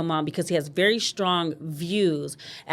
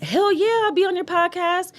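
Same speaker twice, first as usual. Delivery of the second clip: much higher, thrilled acceptance; eager yes; 13 semitones higher than usual. Retold or imitated. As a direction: play the guest who can't wait to say yes. Guest eagerly agreeing to join.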